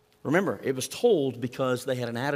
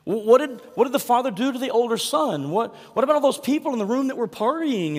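much higher and louder, eager tumbling questions; excited curious listeners; 11 semitones higher, 7 dB louder than usual. Retold or imitated. Breathless mock excitement. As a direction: pastor voicing the crowd craving the story's ending, excitedly. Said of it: "they're like".

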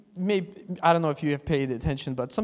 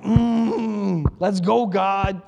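hyped, a bit louder, slow; pumped-up aggressive prayer; 3 dB louder, much slower than usual. Retold or imitated. Pastor imitating a pumped-up aggressive pray-er, louder. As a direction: growl it out like a pre-game pep talk. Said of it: "they become a little aggressive".

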